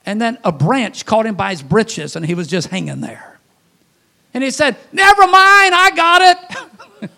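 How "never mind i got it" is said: much higher and louder, dragged out slowly; hammed-up relieved shout. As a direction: brush God off, suddenly cocky. Man caught on branch dismisses God.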